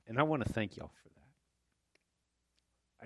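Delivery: quiet, tearful thanks, holding back crying; real emotion catching softly in the voice; choked up. Real, not acted. Preacher thanking the congregation for welcoming Daniel, choked up. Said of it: "I can tell I'm crying".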